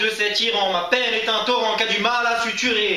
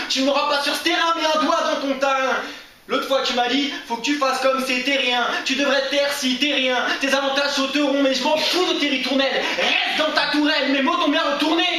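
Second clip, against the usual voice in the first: high-pitched, driving defiance in verse; raised, insistent spoken-word retort; defiant slam climax; 4 semitones higher than usual. Acted. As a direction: throw the rhymes back in their face.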